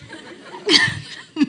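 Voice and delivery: silent airy laugh at himself; breathy laugh. Real, not acted. Laughs at own test.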